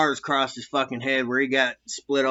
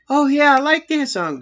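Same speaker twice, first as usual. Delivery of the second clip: high put-on female voice, pleased; imitating the ladies; 11 semitones higher, 5 dB louder than usual. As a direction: lightly mimic a supervisor liking the song. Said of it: "they're like".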